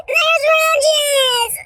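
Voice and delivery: a high wail; wailing friend. Retold or imitated. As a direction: wail it in a put-on girly voice.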